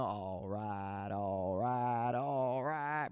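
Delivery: low, lazy drawled all right; McConaughey impression. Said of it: "Matthew McConaughey's breakout role".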